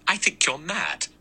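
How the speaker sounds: high, angry outburst; angry cry. A narrator's reading. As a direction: fling it at him, indignant. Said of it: "Vera cried angrily".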